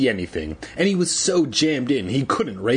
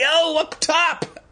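very high, drawn-out whoop; crowing; slower than usual. A narrator's reading. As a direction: celebrate your own jibe, high and slow. Conan crows over his own jibe.

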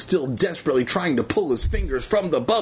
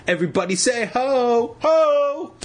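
hype crowd call; 10 semitones higher than usual. Acted. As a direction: pump up the crowd.

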